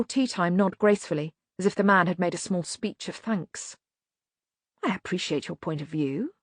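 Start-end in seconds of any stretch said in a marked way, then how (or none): 4.8-6.3 soft, controlled courtesy; mild politeness. A narrator's reading. Tea Time answering the angry locksmith, softly polite. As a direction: answer the rant quietly and politely.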